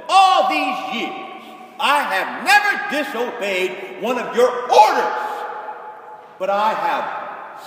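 loud, slow, bitter protest; resentful complaint. Retold or imitated. Resentful son complains loudly to his father, slow and bitter. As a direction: hammer out the grievance. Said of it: "Listen to the way this son speaks".

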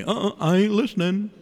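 mock child's refusal; defiant kid. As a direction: play a kid balking at a parent. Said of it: "sometimes your kids say".

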